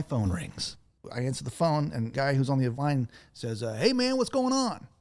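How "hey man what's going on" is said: high, bright-pitched casual greeting; impression of Justin McClure. Radio owner greeting a friend on the phone, high and breezy. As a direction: toss off a chummy hey, pitched way up. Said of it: "That's my impression of, of Justin McClure".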